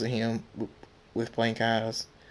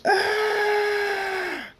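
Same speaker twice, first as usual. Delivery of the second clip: very high, drawn-out agonized scream; long scream of pain; 3 dB louder than usual. A narrator's reading. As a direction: scream on and on under the blows. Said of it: "Thunderstorm screams".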